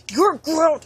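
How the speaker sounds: loud, high mock parental yell; mock outburst. Parent reacting to child being a thespian, mock shout. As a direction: burst out in a hammed-up shout. Joke coming-out to Mom, Dad.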